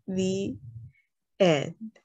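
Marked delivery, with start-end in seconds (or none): none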